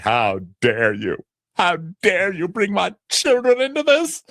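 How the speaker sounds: hammed-up indignation; dramatic outrage. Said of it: "any other Housewives show would be like".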